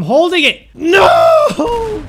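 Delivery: very high, loud crying yell; desperate shout. Real, not acted. Player screaming as his rocket crashes, very high and loud.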